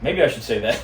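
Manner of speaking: normal talking voice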